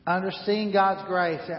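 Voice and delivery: normal talking voice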